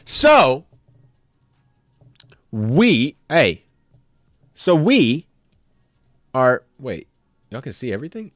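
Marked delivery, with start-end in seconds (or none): none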